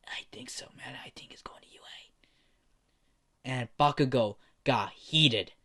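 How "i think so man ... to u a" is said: whispered gossip, slow and hushed; muttered whisper. Acted. Classmate whispering to a friend about Izuku, hushed. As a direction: hush it like gossip behind his back. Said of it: "He could hear the muttering".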